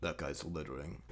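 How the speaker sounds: low, hushed vigilante mutter; hammed-up brooding vigilante; mock-grim Punisher. Punisher acted out spotting litterer.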